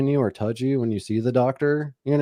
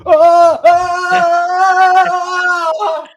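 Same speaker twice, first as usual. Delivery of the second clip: loud, high-pitched yelps of pain, repeated; 12 dB louder, much slower than usual. Retold or imitated. Reenacting the exam for his cohost, loud high pained yelps. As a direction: yelp out loud as the finger goes in. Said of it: "the whole office probably had to hear me just go".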